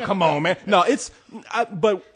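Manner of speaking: ordinary delivery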